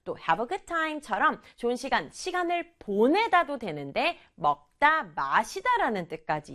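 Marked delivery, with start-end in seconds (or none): none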